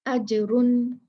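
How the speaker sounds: अजरुन with the jeem quickly bounced and released; qalqalah on jeem. Shown as a demonstration. Teacher giving students the correct अजरुन. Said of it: "जल्दी से जीम कह के इसको छोड़ देंगे".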